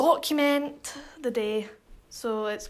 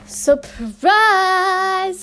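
loud singsong jump-scare yell; surprise shout; 7 semitones higher, 9 dB louder than usual. Real, not acted. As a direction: burst in yelling to scare him. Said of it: "we're gonna go scare him".

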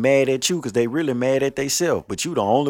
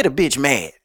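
normal talking voice

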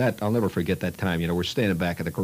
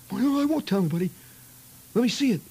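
put-on high, fast boy's voice; pleading kid; 14 semitones higher, faster than usual. Eager kid promising silence to see the finger, high and fast. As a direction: beg to see it, pitched way up.

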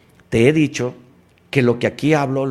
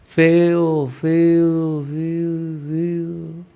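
fading echo; 3 semitones higher, much slower than usual. Shown as a demonstration. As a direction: let each feo die away like an echo. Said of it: "Y él te escucha y te grita".

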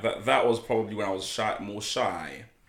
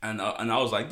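ordinary talk, nothing marked

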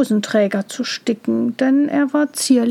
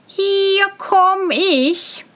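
high, long-held call; singsong cry; 9 semitones higher, much slower than usual. A narrator's reading. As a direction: holler your arrival in a high singsong. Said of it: "schrie Tölpelhans und sang, dass es nur so gellte".

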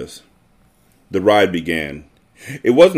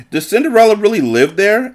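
put-on little boy wondering; 12 semitones higher than usual. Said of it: "Andrew wondered".

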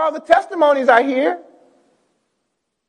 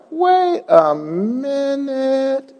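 drawn-out pause; 4 dB quieter, much slower than usual. Real, not acted. Preacher stops himself, drawn out.

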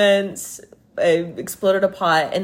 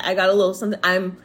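ordinary talk, nothing marked

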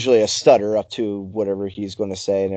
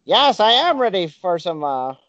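high squeaky puppet voice; squeaky ventriloquist dummy; 7 semitones higher than usual. Pretend. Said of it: "ventriloquist dummy here".

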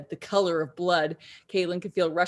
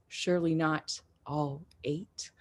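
hushed, breathless whisper; 3 semitones lower, 8 dB quieter, slower than usual. A narrator's reading. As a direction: whisper it fearfully while hiding. Hiding, holding her breath.

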